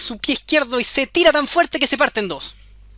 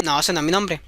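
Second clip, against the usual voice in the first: low, smug refusal; the goblin rejecting the queen's guess; 7 semitones lower, 3 dB quieter than usual. Acted. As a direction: answer low and unbothered.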